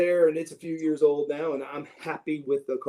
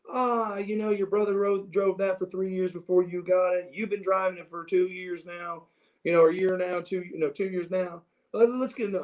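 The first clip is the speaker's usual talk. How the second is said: higher-pitched, insistent dad imitation; mock persuasive dad, pitched up; pushy dad; 4 semitones higher than usual. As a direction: retell a father talking his son into it. Dad acted pressing son to upgrade.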